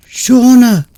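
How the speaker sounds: high, loud incredulous outburst; exclaimed. Acted. Friend snaps at girlfriend about Shauna, angry outburst. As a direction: throw the name back at her. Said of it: "Kendrick exclaimed".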